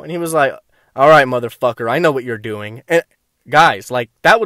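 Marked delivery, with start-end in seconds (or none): 1.0-2.8 a lower, sinister imitation of the smirking friend; lightly voiced paranoid buddy, drop the pitch and threaten; menacing friend. Tripping friend accuses him, a low mock menace.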